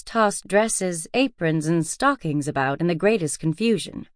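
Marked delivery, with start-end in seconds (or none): none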